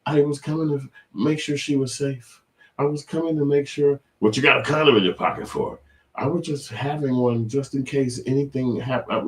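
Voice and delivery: high, pleading mock voice; mock excuse. The caught man excusing himself to the host, high whine. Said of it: "And he'll be like".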